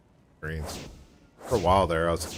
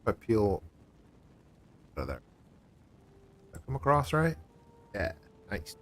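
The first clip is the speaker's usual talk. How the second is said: ordinary talk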